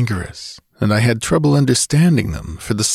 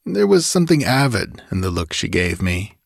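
ordinary delivery

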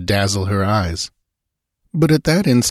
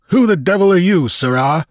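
higher, louder, hostile challenge; unfriendly demand; 10 semitones higher, 5 dB louder than usual. A narrator's reading. Ship's master confronts the intruder, loud and hostile. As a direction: challenge him with raised voice. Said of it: "demanded in no friendly tone".

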